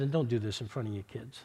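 hushed and humble, asking pardon; sincere apology. Retold or imitated.

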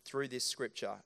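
ordinary talk, nothing marked

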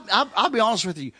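ordinary delivery

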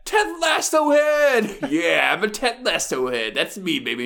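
loud hyped-up fan holler; mimicking the woman. Parodying her at home all week, loud fan voice. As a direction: shout it high, then revel in it. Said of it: "just being like".

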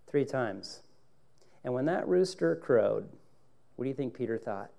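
ordinary talk

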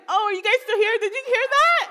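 high, climbing mock-innocent question; sheepish surprise. Woman realizing office heard her yelling, sheepish surprise. Caught out on open line.

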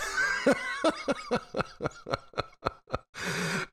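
long high laugh; a long, high, real laugh; real burst of laughter. Real, not acted. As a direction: break into laughter. Laughing at wife's blunt remark.

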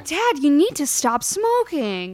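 put-on fed-up kid voice; exasperated child scolding. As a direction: scold your father slowly and loudly. Child scolding smoking father.

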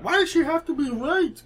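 high, indignant internet voice; mocking internet outrage. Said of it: "Why'd you have to say it like that?".

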